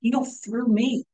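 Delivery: slow, hushed prayer, dropping to a whisper. Shown as a demonstration. Pastor modeling a prayer for healing, hushed and slow. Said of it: "we should say".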